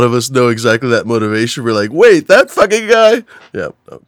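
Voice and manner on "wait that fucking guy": high, slow, louder disbelief; jealous disbelief. Retold or imitated. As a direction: stretch it out, outraged surprise.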